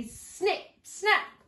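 high, loud punchy snap; dramatic snip snap. A narrator's reading. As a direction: bite the words off like jaws closing. Fox snaps him up.

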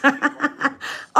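short bright laugh at her own bragging; a giggle at her own boast; self-amused laugh. Real, not acted. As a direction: laugh it off, pleased with herself.

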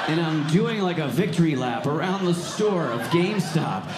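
normal talking voice

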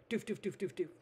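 punchy run of repeated thuds; imitated thudding noise. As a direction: imitate muffled thumping from the basement. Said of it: "I can hear from the basement".

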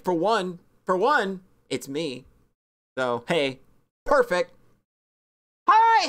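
ordinary talk, nothing marked

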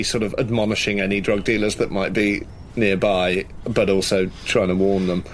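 ordinary talk, nothing marked

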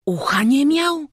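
high, loud incredulous echo; astonished question. A narrator's reading. Townsman echoes boy's news of missing ear, astonished high question. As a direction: repeat it as a question, amazed, voice climbing.

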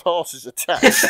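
slow, laughing through the last words; can't hold it together reading the punchline. Real, not acted. Reader cracking up at the stuffed horses, laughing. Reader breaks up mid-line.

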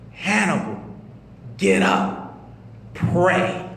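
low unvoiced whisper from nowhere; eerie low whisper. Man alone in bed hears a ghostly voice, low eerie whisper. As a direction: breathe it like a ghostly voice in the dark. Mysterious voice calling him.

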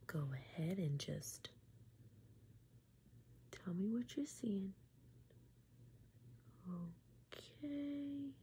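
slow, soft clinical whisper; ASMR eye exam whisper. Acted. ASMR host whispers through an eye exam for the viewer. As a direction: whisper like a gentle doctor up close.

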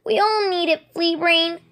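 high sharp snap at a kit; snapping. Acted. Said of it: "she snapped".

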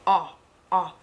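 "Ah, ah" as two separate open vowels; isolated open "Ah", repeated with a gap; short O sound. Shown as a demonstration. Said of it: "Short sound".

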